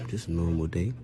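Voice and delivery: usual speaking voice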